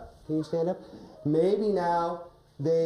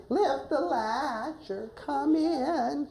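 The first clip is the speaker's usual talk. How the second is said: put-on slow, high old-lady call from the bed; high-pitched grandma voice; 8 semitones higher, much slower than usual. Shown as a demonstration. Coach showing grandma's line to the class. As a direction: stretch it out high and frail. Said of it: "So you could add that in definitely".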